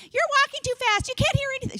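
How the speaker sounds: high, whining mock complaint; whiny sister. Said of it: "She doesn't really talk like this".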